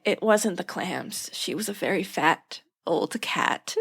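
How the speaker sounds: slow, matter-of-fact doctor; calm doctor. Retold or imitated. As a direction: explain it slowly and dryly. Doctor calmly explains.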